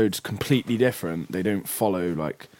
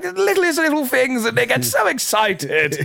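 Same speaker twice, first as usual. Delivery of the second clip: gushing delight; 6 dB louder than usual. Retold or imitated. God gushes over dancing cavemen, delighted. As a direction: squeal as if over cute pets.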